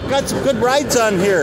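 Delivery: high, thrilled remark; high excited aside. Real, not acted. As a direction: voice lifted with delight at the cars. Excited about train cars.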